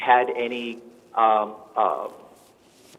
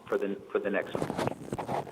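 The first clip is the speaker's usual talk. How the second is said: usual speaking voice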